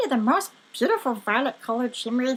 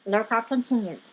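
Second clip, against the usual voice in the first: usual speaking voice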